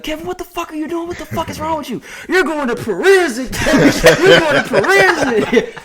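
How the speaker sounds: high, squeaky mock yell at Kevin; the manager catching Kevin in the act, imitated in a high mock yell; mock angry manager. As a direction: ham up the furious boss catching him.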